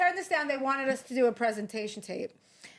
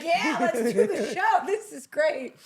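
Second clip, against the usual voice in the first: higher, slow, loud and thrilled; eager network exec; 6 semitones higher, 4 dB louder, slower than usual. Retold or imitated. Network execs cheering the drama, mock enthusiastic. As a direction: gush like a delighted executive. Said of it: "the network was like".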